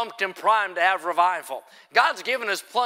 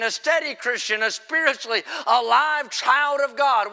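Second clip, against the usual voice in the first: raised-pitch, driving revival preaching; emphatic pulpit delivery, pitched up; forceful preaching; 5 semitones higher than usual. Real, not acted. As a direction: preach it with rising fervor.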